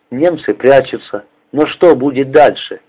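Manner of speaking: usual speaking voice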